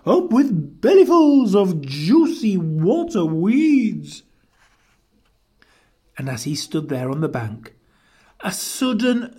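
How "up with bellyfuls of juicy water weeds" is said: hooting cheer. A narrator's reading. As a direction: hoot it, drawing out the last words. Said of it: "and they hooted".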